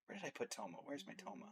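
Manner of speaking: very soft searching mumble; muttering to oneself. Real, not acted. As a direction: say it under your breath, hunting for something. Muttering to self while searching.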